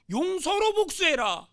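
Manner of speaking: slow, loud, high proclamation; emphatic command. Acted. Preacher voicing the Bible's teaching, loud slow command. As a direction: drive the command home word by word.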